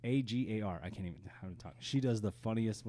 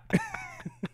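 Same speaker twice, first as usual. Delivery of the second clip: high burst of laughter. Real, not acted. Laughing at own joke.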